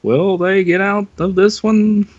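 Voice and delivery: high, cliffhanger announcer line; cartoon announcer. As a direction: ham up the cartoon narrator. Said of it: "You know how, like, Bullwinkle used to end?".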